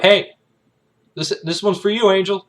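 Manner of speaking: high, loud call to a buddy; imitated shout. One soldier shouting to the other one, imitated shout. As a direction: shout it over to another soldier. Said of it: "One soldier shouting to the other one".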